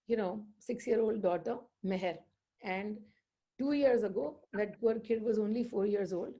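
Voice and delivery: normal talking voice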